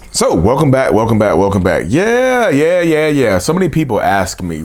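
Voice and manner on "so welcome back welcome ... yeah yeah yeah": loud, pumped-up welcome back; bursting back on mic, loud and hyped. Real, not acted. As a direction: greet the audience with a burst of energy. Hyped return after a break.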